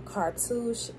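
ordinary talk

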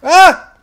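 short loud high yelp; startled yelp. Real, not acted. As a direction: yelp in sudden alarm. Startled in game fight.

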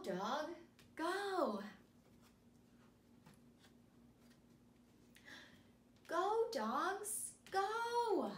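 slow, high, sing-song picture-book reading; animated storybook voice for young kids; reading to children. Acted. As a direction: read it big and bright for little listeners. Reads picture book to students.

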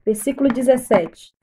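ordinary talk

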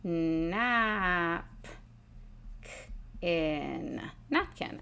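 napkin split into nap and kin, then said whole and rising; syllable by syllable, then whole; syllabicating. Shown as a demonstration. Teacher modelling syllable split of napkin. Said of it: "syllabicate the word napkin".

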